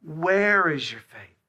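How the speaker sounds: slow, loud, tender asking; a slow, gentle question. Retold or imitated. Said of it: "What does he say?".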